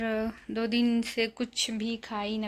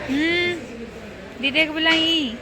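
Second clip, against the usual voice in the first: coaxing, sing-song comfort, a bit loud; gentle reassuring coaxing; 6 dB louder than usual. Real, not acted. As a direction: hush a tearful little one and promise her sister is coming. Comforting a crying little girl.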